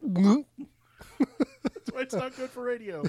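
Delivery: high, loud, slow words through laughter; can barely get it out for laughing; laughing hard. Real, not acted. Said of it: "I just heard you swallow a circus peanut".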